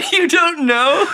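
high, drawn-out disbelief through a laugh; mock the hedge, high and slow; incredulous mock. Reacting to a president's quote, laughing incredulity.

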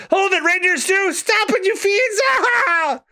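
high, panicked critter squeal; squeaky frantic voice. Acted. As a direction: shriek it out while fleeing. Luffy and Chopper in a chase.

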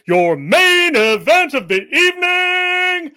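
big showman announcement to the crowd; ringmaster. Pretend. Announcer introducing the main event.

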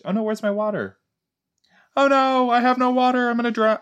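very high, hammed-up panicky voice; mock panic. Joking that the water is missing, mock panic. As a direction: squeal it in pretend alarm.

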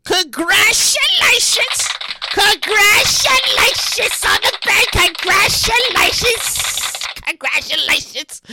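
high, very slow stretched-out cheer; drawn-out announcer shout. Acted. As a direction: stretch every syllable like a hype announcer. Celebrating the baby news.

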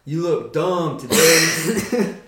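modern-day talk. Acted. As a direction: send up modern talk, drawn out and loud. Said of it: "Now it's like".

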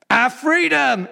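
high, loud climactic shout; rallying battle cry. Acted. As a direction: cry it out to a whole army. Climax of acted battle speech.

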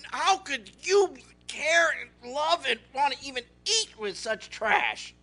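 acted indignant disgust; livid. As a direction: demand it in appalled disbelief. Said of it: "you could see their brains frying".

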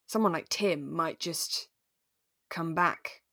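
ordinary delivery

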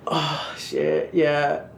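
soft breathy moan. Retold or imitated. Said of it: "I'm like".

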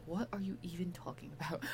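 whispered, bewildered question; hushed disbelief, all breath; baffled whisper. Acted. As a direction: imitate a baffled reply under the breath. Voices Sean's baffled reply.

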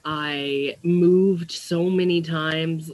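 ordinary talk

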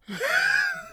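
very high wheezing laugh. Real, not acted. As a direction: crack up into a squeal. Cracks up after his outburst.

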